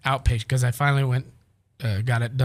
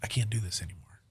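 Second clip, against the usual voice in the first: hushed whisper; 3 semitones lower, 7 dB quieter, faster than usual. Retold or imitated. Retold desperate midnight call.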